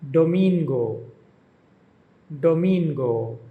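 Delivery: "domingo" with the weight on MIN; do-MIN-go, "domingo" peaking in the middle, then falling; stress on second syllable. Shown as a demonstration. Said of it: "emphasis on the second syllable".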